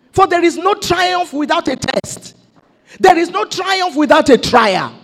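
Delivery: ordinary delivery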